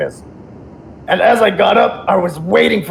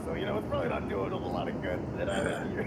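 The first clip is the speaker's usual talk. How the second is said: much softer and faster, thrown away under the breath; muttered aside; 12 semitones lower, 19 dB quieter, faster than usual. Shown as a demonstration. Guest repeating his own joke to himself, quick soft mutter.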